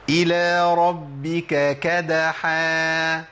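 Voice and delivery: "كدحا" very slow with a light kaf; recited with kaf. Shown as a demonstration. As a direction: chant "إلى ربك كدحا", kaf at the front. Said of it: "بالكاف". (تلاوة بطيئة بالكاف)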